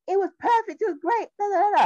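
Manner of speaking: high singsong fake praise; sugary mock rave; mock gushing. Mimics praise she can't give.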